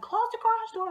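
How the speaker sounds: high-pitched imitation of a brother's call; calling back. Brother reminding little sister about the garage, calling back. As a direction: mimic someone hollering an order from another room.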